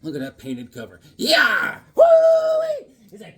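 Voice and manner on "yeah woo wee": loud, very high hammed-up whoops; cowboy whooping. Whooping at a western comic cover.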